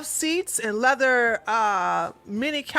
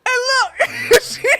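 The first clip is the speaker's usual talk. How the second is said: much higher and louder, laughing through the words; helpless laughter; 12 semitones higher, 7 dB louder than usual. Real, not acted. Teller losing it over her friend's come-on, laughing hard. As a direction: crack up while trying to talk. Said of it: "'Cause I'm laughing most of the time".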